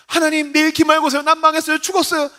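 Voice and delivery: high-pitched student panic; acted desperate exam-eve complaint; lament. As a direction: wail to God about impending doom. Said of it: "한탄하다가".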